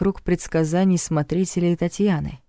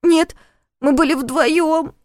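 put-on high tearful boy, slow; whiny drawl; 11 semitones higher, 3 dB louder, slower than usual. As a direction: drag it out, close to tears. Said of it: "плаксиво протянул Сёмка".

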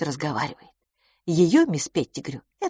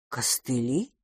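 low, softer questioning repeat; puzzled echo; 5 semitones lower, 6 dB quieter, slower than usual. A narrator's reading. Miss Pettigrew repeats the odd word, questioning. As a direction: repeat the word lower, rising at the end. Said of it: "повторила мисс Петтигрю".

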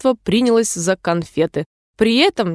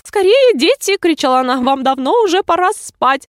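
very high, loud, flustered canary; alarmed mother bird, urgent and high; trembling call; 8 semitones higher, 3 dB louder than usual. A narrator's reading. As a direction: call the chicks in a panicky high voice. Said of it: "дрожащим голосом позвала своих птенчиков".

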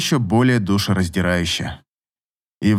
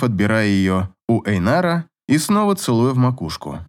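usual speaking voice

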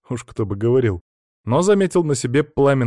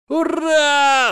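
very high, loud shriek of joy; shouted, piercing hurrah; squealing cheer; 6 dB louder than usual. A narrator's reading. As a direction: squeal it out, stretched long. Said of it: "заверещал Саня".

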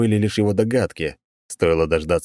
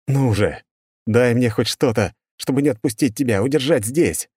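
desperate pleading; 5 semitones higher than usual. Acted. Desperate mental plea to girl.

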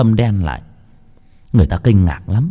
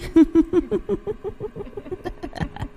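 high, soft ghostly laugh fading away; eerie demon laugh; 10 dB quieter than usual. Acted. Possessed sister-in-law laughing at terrified Út Phượng, eerie high laugh. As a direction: let a thin high cackle trail off.

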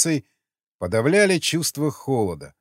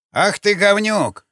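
put-on high, slow, loud old man's outburst; a cranky old guard bursting out; old man's angry exclamation; 7 semitones higher, 4 dB louder, slower than usual. A narrator's reading. Old guard yelling at the intruder, high and loud. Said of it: "воскликнул выскочивший на площадку старик".

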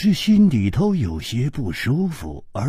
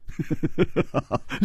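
teasing chuckle; 7 dB quieter than usual. Acted. 季冰燕 chuckles before teasing.

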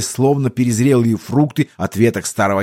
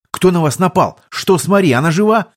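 shouting; 7 semitones higher than usual. A narrator's reading. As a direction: shout the questions in alarm. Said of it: "крикнул я". (крик)

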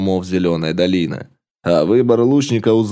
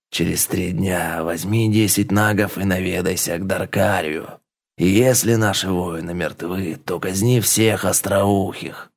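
serpent chief voice; 3 semitones lower than usual. Acted. Chief Raga gives orders.

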